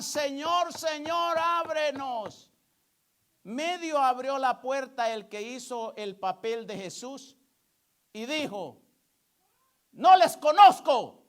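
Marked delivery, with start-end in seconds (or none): 0.0-2.5 raised, higher-pitched pleading call; acted plea at a shut door; imitated cry. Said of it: "cuando gritaban". 9.9-11.2 loud, high, commanding rebuff; booming acted refusal; with authority. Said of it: "pero con autoridad".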